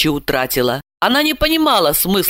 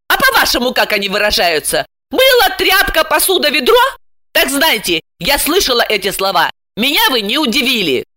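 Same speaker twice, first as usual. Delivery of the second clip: higher-pitched, heated retort; flaring up; 6 semitones higher than usual. A narrator's reading. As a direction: fire back, stung. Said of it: "Ася вспылила". (вспылив, запальчиво)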